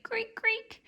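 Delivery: high, soft mimicked creak; creaky wheel. The hamster's wheel creaking round in the story. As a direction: make the wheel squeak as it turns. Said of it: "the hamster's wheel went creak".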